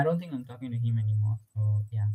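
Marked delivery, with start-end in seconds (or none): none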